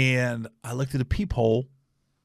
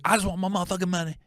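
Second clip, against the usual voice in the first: quick, higher-pitched insistent imitation; mimicked demanding woman; 5 semitones higher, much faster than usual. Woman answering the cop's offer, quick high demand. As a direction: rattle off her whiny demand.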